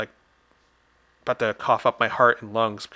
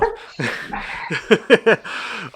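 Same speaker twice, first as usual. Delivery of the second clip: loud laugh; 9 semitones higher, 5 dB louder than usual. Real, not acted. Laugh after joke.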